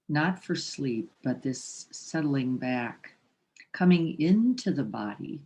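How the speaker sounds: slowing meditation voice, softening toward the end; guided meditation. Real, not acted. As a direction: ease them into stillness with long pauses. Guides the mind to settle.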